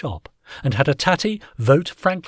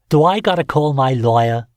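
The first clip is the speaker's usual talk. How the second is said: defiant landlord voice; 3 semitones higher, 6 dB louder than usual. A narrator's reading. Tinkler defiant.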